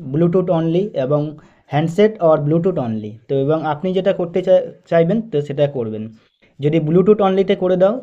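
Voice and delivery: usual speaking voice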